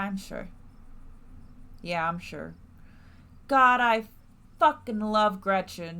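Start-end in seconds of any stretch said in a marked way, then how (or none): 3.5-6.0 louder, thrilled outburst about Gretchen; excited gush. Real, not acted. Player gushing about her favourite character, excited and loud. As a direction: blurt out your love for the character. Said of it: "gushes about a character".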